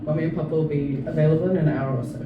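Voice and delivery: ordinary talk, nothing marked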